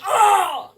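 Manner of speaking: loud, high "oh" as if burnt; acted-out shriek at a sudden burn; yell of pain. Acts out burning their fingers.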